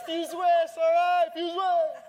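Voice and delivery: loud, high call to the crowd; shouted hype. Real, not acted. Pastor hyping the congregation, a loud rally shout. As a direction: fire up the room with the name.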